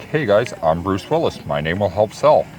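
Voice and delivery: ordinary delivery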